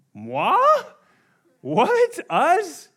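high squeaky feigned shock; hammed-up "who, us?" outrage; mock indignant surprise. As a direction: play the offended innocent, shrill and high. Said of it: "would respond audaciously".

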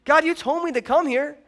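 high, shocked "what the heck"; mock indignation. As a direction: play Elijah as baffled and put out. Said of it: "I'd be like".

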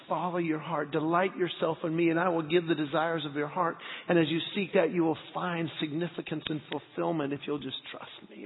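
soft, low-key voice of God; gentle inner whisper. Retold or imitated. God reassuring the pastor's heart, softly. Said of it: "God just whispers in my heart".

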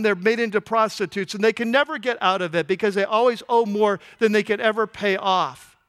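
ordinary delivery

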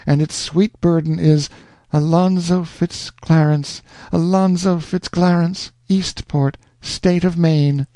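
put-on rapturous young woman. Rosanna's rapturous love speech.

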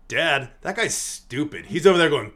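a hint of a child asking Dad, drawn out; lightly imitating a naive kid; innocent little kid. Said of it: "innocent little kid".

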